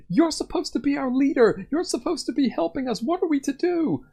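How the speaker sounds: high, accusing shout; villager yelling. Acted. A frightened townsperson yelling at their leader, high and accusing. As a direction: shout up at the leader, demanding help. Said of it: "someone else is yelling".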